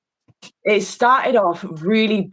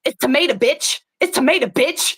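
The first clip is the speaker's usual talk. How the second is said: mock insult; 6 semitones higher than usual. Acted absurd drill insult.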